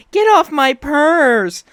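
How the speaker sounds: slow, loud mocking yell of the owner; a hammed-up angry owner guarding her purse; mocking Violet. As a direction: shout it back at her, slowly and mocking. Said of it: "she's like".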